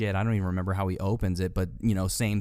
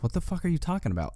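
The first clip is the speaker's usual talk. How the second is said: fast Donnie quote; 7 semitones higher, much faster than usual. Retold or imitated. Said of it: "Donnie says to Walter".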